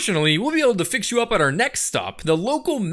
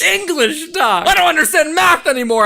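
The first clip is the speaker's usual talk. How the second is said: exasperated outburst; 8 semitones higher, 6 dB louder than usual. Acted.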